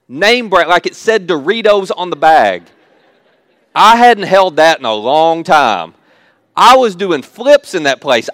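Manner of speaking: loud, drawn-out thrill; re-enacted excitement. Speaker relives seeing name-brand chips as a broke graduate, excited. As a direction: play up being thrilled, loud and emphatic. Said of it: "What got me so excited".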